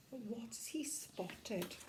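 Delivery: hushed, secret whisper. Acted. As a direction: whisper to build suspense. Storyteller teasing suspense.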